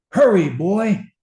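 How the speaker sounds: high, loud call across the train; shouted order. Acted. Thirsty soldiers shouting at the water carrier, loud. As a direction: yell it at the water boy. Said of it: "we shouted".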